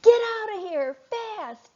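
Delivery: high, loud fish yell; frantic high yell to flee. Acted. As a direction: shout him away in a panic. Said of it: "Fitzwater yelled".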